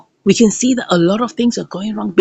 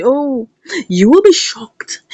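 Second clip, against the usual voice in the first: normal talking voice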